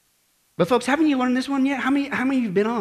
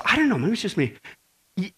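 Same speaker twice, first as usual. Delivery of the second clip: usual speaking voice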